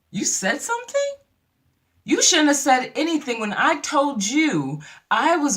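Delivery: high-pitched incredulous telling-off; acted outrage, starting very high; imitating a friend confronting her in disbelief; indignant scolding. Acting Nene scolding [name].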